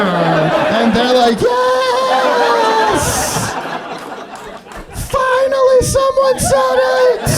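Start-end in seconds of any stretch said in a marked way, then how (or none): 1.4-7.3 very high, drawn-out cheer; elated shout. Acted. Parents agreeing with the shocking joke, elated shout. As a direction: cry it out in triumphant relief.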